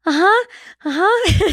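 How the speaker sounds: squeaky nervous agreement, up high; eager high uh-huh. Retold or imitated. Nervous patient agreeing to the shaman, high squeak. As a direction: squeak out a quick nervous yes. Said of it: "And I was like".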